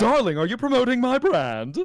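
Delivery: high, loud theatrical gushing; hammed-up darling. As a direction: put on a big, swooping diva voice. Playful put-on line interrupting friend.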